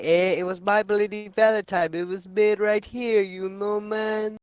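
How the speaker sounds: loud, high, hammed-up Canadian accent. Pretending to be a Canadian moviegoer, loud mock Canadian accent.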